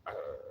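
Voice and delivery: low, soft dog grumble; imitated dog grumble. Owner imitating his dog's reply, low grumble. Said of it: "he'll be like".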